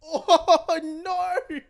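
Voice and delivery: high, loud laughing "oh no"; laughing reaction. Real, not acted. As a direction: burst out laughing through the words. Reacting to the dental story.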